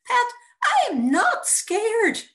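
high, loud, slow strangled falsetto. Acted. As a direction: squeeze it out in a tight falsetto. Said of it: "my voice strangled falsetto".

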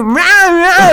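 loud, high mock roaring. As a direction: bellow like a raving man. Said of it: "he starts going".

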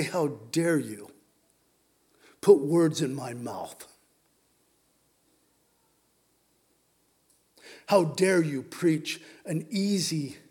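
low, measured rebuke; John Calvin rebuking. Acted. Calvin to modern Calvinists, stern low-voiced rebuke. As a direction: rebuke them gravely with weighted pauses. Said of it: "he would probably rebuke them".

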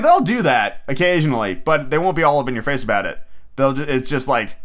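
ordinary talk, nothing marked

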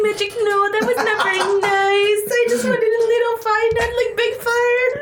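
high, fast, whiny plea; pleading with magic. Pretend. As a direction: scold the magic like a sulky kid. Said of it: "Can I be like".

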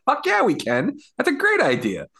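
excited agreement. Retold or imitated. Agreeing to drink ginger ale together, mock excited. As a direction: jump at the idea with glee. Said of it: "I was like".

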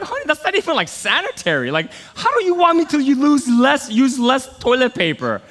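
high, loud incredulous pleading; mock outrage at a petty complaint; husband protesting to wife about toilet paper, incredulous; exasperated protest. Arguing with wife over toilet paper.

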